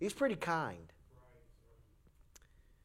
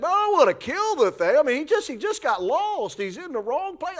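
higher, louder mock Eli voice; imitating Eli; 10 semitones higher, 5 dB louder than usual. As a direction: play the calm neighbor defending the critter. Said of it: "and he's like".

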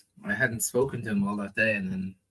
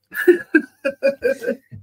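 long high, loud laugh; 8 dB louder than usual. Real, not acted. Guest laughing after retelling Bobby's refusal, loud and high. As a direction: crack up loudly at a refusal.

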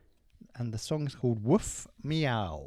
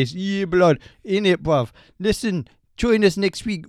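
loud, high London street slang; roadman voice; 5 semitones higher, 10 dB louder than usual. Acted. Signing off the podcast as their resident roadman, loud and high. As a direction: give it full roadman swagger. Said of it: "He's our resident roadman".

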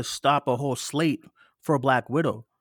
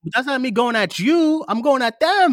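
raised, louder protest; heated insistence; 7 semitones higher, 6 dB louder than usual. Real, not acted. Host tells co-host he's going at them, heated. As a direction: insist with voice high and strong. Heated self-defence in argument.

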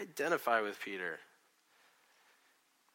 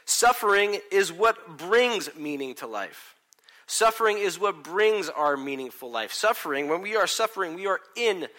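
emphatic preaching; 6 semitones higher, 9 dB louder than usual. Real, not acted. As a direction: hammer the thesis to the room. Preacher building to key claim.

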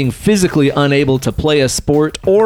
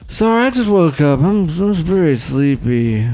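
sleepy [name]; 3 semitones higher than usual. Pretend. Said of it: "I didn't know [name] was gonna show up".

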